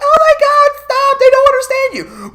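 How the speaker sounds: high, loud mock kid pleading; panicking kids. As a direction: squeal it like a frantic child. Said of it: "the kids were like".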